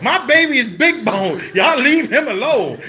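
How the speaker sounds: high, indignant mom defending her son. Retold or imitated. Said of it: "and she's like".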